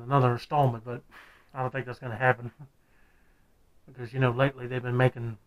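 muffled by rubber mask; through a latex mask. Real, not acted. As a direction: mask pressed over mouth. Seen 3: Full latex head mask worn over face throughout; R frames bare-faced.

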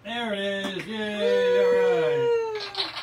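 loud, high whoop of delight; excited cheer. Real, not acted. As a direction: cheer it out, thrilled. Prize pops out of machine.

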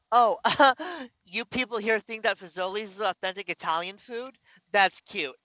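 mocking scoff with a high laugh and a lofty sneer; condescending laugh. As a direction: talk down to him, amused and superior. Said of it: "Like that".

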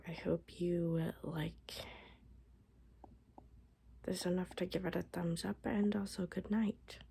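hoarse whisper; voice giving out. Real, not acted. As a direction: voice nearly shot. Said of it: "my voice is about shot".

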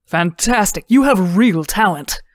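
enthusiastic praise. A narrator's reading. Husband praising Lindsay's talent, enthusiastic. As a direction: gush with admiration. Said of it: "the husband said".

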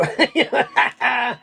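short real laugh breaking out; amused chuckle. Real, not acted. Preacher chuckling at his own aside, amused chuckle.

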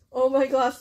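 normal talking voice

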